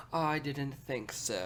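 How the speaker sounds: slow, drawn-out British teacher's put-down; deadpan mock-British, very slow; dry British accent. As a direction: imitate a dry British professor, stretching each word. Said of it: "so, like, dry".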